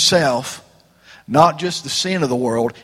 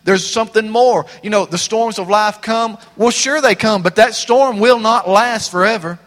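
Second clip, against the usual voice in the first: raised, fired-up preaching voice; high, loud pulpit delivery; 6 semitones higher, 4 dB louder than usual. Acted. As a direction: preach it out to the congregation. Opening lines preached high and loud.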